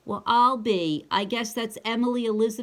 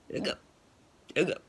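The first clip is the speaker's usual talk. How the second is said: realistic hiccup; 4 semitones lower, much faster than usual. Shown as a demonstration. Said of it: "That's probably a better one".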